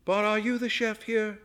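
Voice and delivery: high, toneless demanding question; toneless, shrill. A narrator's reading. Guest cornering the chef, high toneless demand. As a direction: keep the pitch up, drained of feeling. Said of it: "her voice toneless and shrill".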